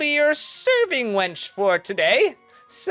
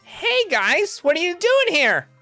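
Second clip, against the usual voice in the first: high, startled hey guys; surprised greeting; 13 semitones higher, slower than usual. Acted. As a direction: caught off guard, voice jumps up. GM voices James surprised.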